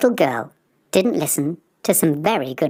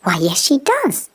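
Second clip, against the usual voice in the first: mild surprise; 8 semitones higher than usual. Acted. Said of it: "very surprised".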